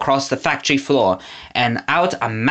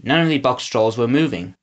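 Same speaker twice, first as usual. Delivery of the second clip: plain reading voice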